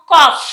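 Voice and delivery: కాఫ్ ending in a strong "fff"; కాఫ్ short, with an audible f release; final F sound. Shown as a demonstration. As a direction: push out the closing f of కాఫ్. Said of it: "ఫా sound వస్తుందా".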